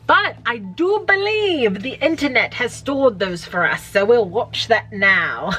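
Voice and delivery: put-on playful TV-psychic accent, starting high. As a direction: put on a theatrical hotline-psychic accent, stretching the ending. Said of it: "I don't know why I did that accent".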